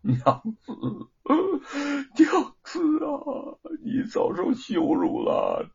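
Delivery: weep over her, words breaking apart; husband sobbing remorse to his wife; bitter weeping. A narrator's reading. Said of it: "痛哭起来".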